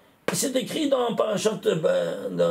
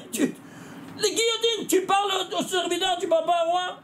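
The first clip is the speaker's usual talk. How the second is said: higher, pitched-up scolding of a pupil; mock outrage; 7 semitones higher than usual. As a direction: act shocked at the pupil's mistake. Scolds pupil for profane talk.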